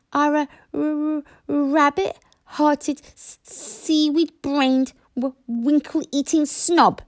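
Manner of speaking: high, slow stuttering insults; stuttering dragon insults. Acted. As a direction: list the insults with cheeky relish. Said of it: "said Toothless calmly".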